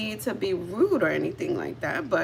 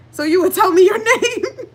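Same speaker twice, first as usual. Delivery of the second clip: high, loud, bursting into laughter mid-line; cracking up while quoting him; laughter takes over the quote; laughing; 10 semitones higher, 10 dB louder than usual. Real, not acted.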